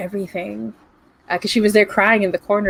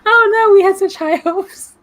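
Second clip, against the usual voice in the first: high, loud mock despair over a bad roll; mock dismay; 12 semitones higher, 6 dB louder than usual. Groaning to the table over the ruined roll, mock dismay. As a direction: wail it as a playful lament.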